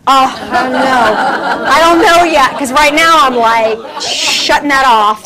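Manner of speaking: loud laughing reply; flustered laugh. Real, not acted. Laughs off audience member's question.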